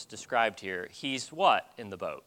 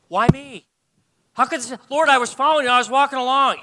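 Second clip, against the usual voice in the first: anguished complaint; 10 semitones higher, 9 dB louder, faster than usual. Retold or imitated. Believer complaining to God.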